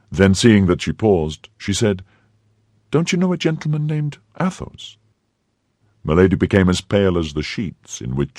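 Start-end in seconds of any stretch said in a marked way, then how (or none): 2.9-5.0 put-on gentle young woman voice; light higher girlish voice; novice voice. A narrator's reading.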